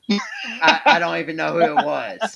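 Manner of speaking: laughing through the words; real laugh breaking into the line. Real, not acted. Wrestling podcaster laughing at forgetting who was in the match, laughing.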